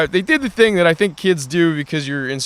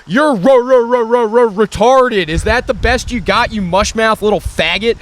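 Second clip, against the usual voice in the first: mocking stutter; 8 semitones higher, 4 dB louder than usual. Mocking the kid's stutter back at him, a hammed-up stammer. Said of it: "And I said".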